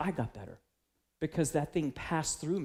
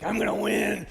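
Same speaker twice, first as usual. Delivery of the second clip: mock gamer boast; 5 semitones higher, 7 dB louder than usual. Retells his gaming self-talk.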